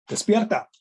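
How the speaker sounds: sudden shout, pitch jumping up high; loud wake-up call. Shown as a demonstration. Preacher shouting at the congregation to wake up. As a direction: shout it to wake the sleeping crowd. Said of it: "Oh, disculpen, los desperté".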